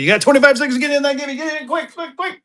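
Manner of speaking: high-pitched, hurried countdown call; fast, squeaky hurry-up; rapid urging. Real, not acted. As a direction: rush them in before time runs out. Rushing viewers into the giveaway.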